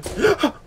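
high, sudden gasp of fear; sharp scared intake; frightened gasp. Real, not acted. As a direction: gasp at a horrible sight. Sees monster in the basement.

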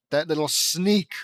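loud, slow, outraged exclamation; exclaiming. A narrator's reading. Narrator discovering the bugged tiara, loud and outraged. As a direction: spit out the name-calling at the sneaky sister. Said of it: "I exclaim".

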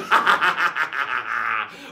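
loud rattling guffaw; pirate laugh. Acted. Pirate storyteller enjoys his tale, loud guffaw. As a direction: let out a big staccato pirate cackle.

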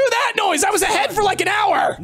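high, indignant gamer rant; raging gamer. Real, not acted. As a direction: vent at losing the lead. Knocked out of the lead.

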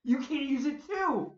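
high, loud, slow indignant question; loud protest; indignant demand. Retold or imitated. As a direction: demand an answer, outraged. Outraged disbelief at the airline.

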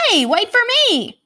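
put-on high, loud little-kid call. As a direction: call after your big sister. Little sister calling out.

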